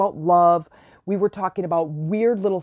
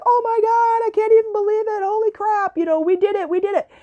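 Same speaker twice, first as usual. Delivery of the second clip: squealing high, laughing disbelief; excited screaming. Retold or imitated. Two friends celebrating their manifesting, high excited squeals. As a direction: shriek it like you just won. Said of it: "laughing out loud and screaming like".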